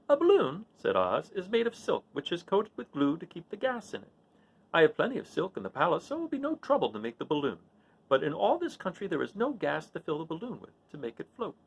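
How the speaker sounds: a fussy little man thinking aloud; the little wizard talking Dorothy through his plan. Acted. Oz explains the balloon.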